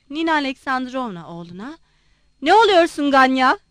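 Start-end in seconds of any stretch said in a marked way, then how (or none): 2.4-3.6 louder alarmed cry to her son; mother crying out at Ganya; cried out. A narrator's reading. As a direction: call out sharply to your son. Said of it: "diye bağırdı".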